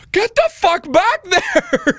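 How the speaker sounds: high, slow screeching yell; nurse yelling. Retold or imitated. Triage nurse ordering the burned patient back, shrill slow yell. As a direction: bellow like an angry nurse. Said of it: "the nurse is like".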